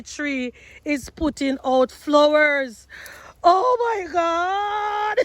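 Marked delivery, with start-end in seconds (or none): 3.4-5.2 overwhelmed. Real, not acted. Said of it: "first cherry flower".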